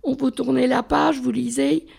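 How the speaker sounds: ordinary delivery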